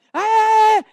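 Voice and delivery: high, loud whooping cheer; mock crowd cheer. Crowd whoops at the man hit by the pie, loud cheer. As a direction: yell it like the jeering crowd at the wall.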